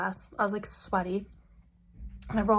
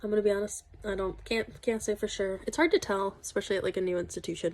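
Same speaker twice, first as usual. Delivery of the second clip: usual speaking voice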